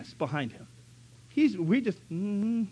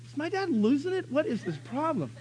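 put-on higher, bewildered young voice; puzzled kid; 8 semitones higher, 4 dB quieter than usual. Boy wondering about his dad, confused. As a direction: sound like a confused little boy. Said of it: "I'd be like".

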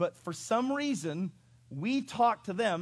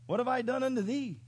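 higher, plaintive donkey voice; talking donkey; 5 semitones higher than usual. Acted. Donkey questioning the master who beat her, mock-plaintive. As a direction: plead like a wronged donkey. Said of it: "she said unto Balaam".

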